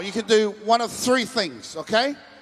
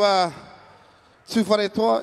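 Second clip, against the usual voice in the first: ordinary delivery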